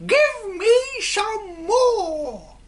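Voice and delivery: put-on shrieking old hag's whoop, very high and loud; cackling witch-like cry of glee; old hag shrieking with delight; screeching shout. A narrator's reading. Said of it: "she cried".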